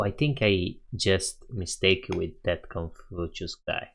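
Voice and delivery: normal talking voice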